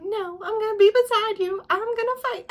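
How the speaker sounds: mocking sing-song heroine voice; mock earnest heroine. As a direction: parody her noble resolve. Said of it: "she's like".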